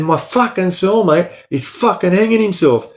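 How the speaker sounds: high, strained urgent shout; retold desperate yell. Inmate shouting to guards his cellmate is hanging, high and strained. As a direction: yell it through the door, panicking. Frantic call to guards through door.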